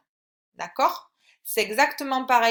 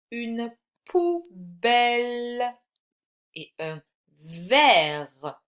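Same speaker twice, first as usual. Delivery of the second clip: very slow, stretched-out syllables; slow dictation; 4 dB louder, much slower than usual. Shown as a demonstration. Teacher names pictures for pupil to write, slow dictation. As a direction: dictate slowly, leaving space between syllables.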